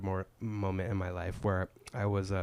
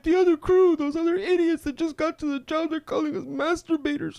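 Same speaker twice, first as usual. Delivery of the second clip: very high, louder upset squeal; whiny girly voice; 9 dB louder than usual. Retold or imitated. Coworker complaining to the crew, very high whine. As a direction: shriek it up high like a tattling kid.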